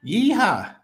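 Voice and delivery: real triumphant yeehaw; excited whoop. Real, not acted. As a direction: cheer it out like a rodeo win. Celebrating referral milestone on stream.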